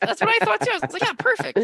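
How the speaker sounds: high laughing voice. Real, not acted. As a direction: laugh through it. Laughing at plot twist.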